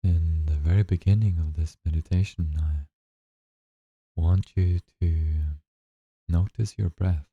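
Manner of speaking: slow, calm meditation guide voice; guided meditation. Acted. Guide leading listeners through their first breathing meditation, slow and calm. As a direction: ease them into the breath, unhurried and gentle.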